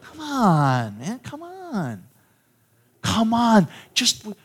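high, drawn-out beckoning; playful, stretched-out plea; pleading coaxing. Acted. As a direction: coax them over with long, pleading calls. Mimes beckoning reluctant people to come.

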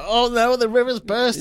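high, drawn-out fake alarm; mock panic. Co-host imitating residents who overreact to flooding, mock panicking. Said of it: "People will be panicking, like".

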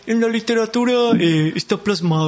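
mock learned man, pitched a little up; self-styled man of letters. Host mocking the radio man's claim, imitated learned voice. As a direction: imitate someone who thinks himself well-read. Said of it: "se considera alguien letrado".

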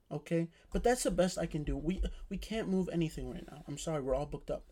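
soft, careful apology; apologetic manager. Retold or imitated. Manager telling mom the rooms are booked, softly apologetic.

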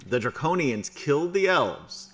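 normal talking voice

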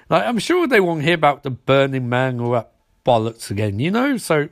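ordinary talk, nothing marked

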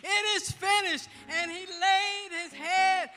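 loud, rising preacher's cry with a held tune; full-force preaching. Acted. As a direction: preach it out to the congregation, building to a shout.

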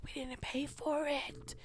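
hushed, nervous whisper about not paying; scared whisper. Retold or imitated. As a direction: whisper it so nobody in the store hears. Whispering in the store aisle.

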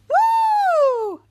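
very high, loud long whoop; celebratory woo. Real, not acted. Host finishing the recap, high loud whoop.

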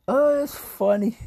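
high, loud, drawn-out laughing voice; tickled, pitch way up; amused. Real, not acted. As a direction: squeal it out after laughing. Laughing at own accidental yawn.

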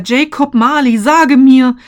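loud, high begging, sinking to a whisper; pleading. A narrator's reading. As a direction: implore him desperately. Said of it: "flehte er".

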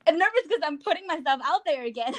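high, fast nervous excitement; giddy nerves, rushing the words; excited dread. Real, not acted. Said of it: "I'm excited and nervous".

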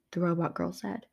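plain reading voice

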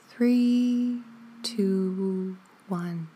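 slow, drawn-out counting with even spacing; guided breath count. Shown as a demonstration. Teacher counting listeners in to four and out from eight, measured and sustained. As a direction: count them gently through a long breath.

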